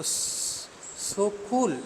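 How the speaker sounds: "so" hushed to a whisper, "cool" voiced out; slowly drawn-out "so COOL", first word soft, second stressed; unstressed-stressed demo. Shown as a demonstration. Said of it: "so मैंने धीरे बोला". ("so" बहुत धीरे, "cool" ज़ोर देकर)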